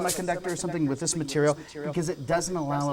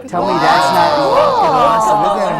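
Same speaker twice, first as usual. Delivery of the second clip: excited shout; 7 semitones higher, 12 dB louder than usual. Real, not acted. Boat floats on heavy gas.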